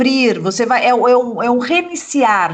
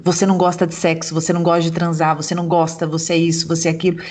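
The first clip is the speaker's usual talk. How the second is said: retold belittling refrain; 7 semitones lower, faster than usual. Client quoting years of being told who she is, retold belittling refrain. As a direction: imitate a repeated reproach, pitched down and hurried.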